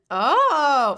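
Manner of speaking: ordinary talk, nothing marked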